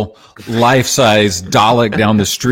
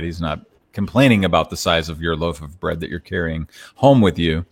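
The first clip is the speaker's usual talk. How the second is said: usual speaking voice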